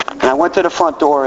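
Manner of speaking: ordinary talk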